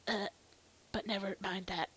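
usual speaking voice

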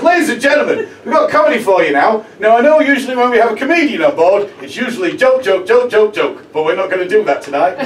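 loud showman compere voice; compere announcing. Retold or imitated. Host introducing the comic to the crowd, booming announcer. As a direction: belt it out like a cruise-ship host. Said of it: "He comes out and goes".

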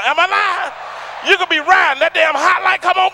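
high, loud excited rant; excited shouting. Real, not acted. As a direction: yell it with glee. Excited about Krispy Kreme.

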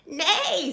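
high, loud neigh; horse neigh. A narrator's reading. Storyteller plays the horse, high neighing. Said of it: "said the horse".

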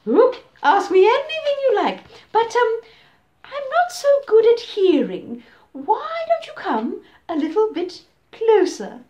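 loud, wheedling crocodile voice; sly crocodile. Acted. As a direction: lure him in sweetly. Crocodile luring the elephant.

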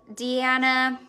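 usual speaking voice

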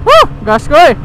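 high, loud cheer to go; excited cheer. Real, not acted. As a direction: hype yourself up to ride. Revving off on motorbike.

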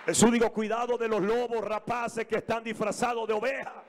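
high, fired-up pulpit preaching; rally the church from the pulpit; preaching at full force. Acted. Pastor rousing the congregation with fiery preaching.

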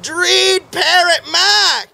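drawn-out, slow title; slow mock announcement. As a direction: stretch the name out slowly. Slow mocking name.

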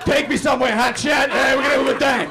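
hammed-up sultry come-on with a laugh, building to a shout at the end; old prostitute. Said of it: "I talk to Uber drivers like an old prostitute".

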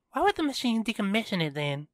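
high, quick exasperated question; huffed. A narrator's reading. Kiara challenges Tristan on the machine, huffed. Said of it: "Kiara huffed".

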